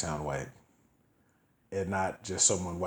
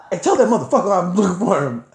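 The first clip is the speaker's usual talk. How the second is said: loud, high retold threat; retold furious demand; angry shouting; 9 semitones higher, 10 dB louder than usual. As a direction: holler the order as the angry man. Retells angry man's demand.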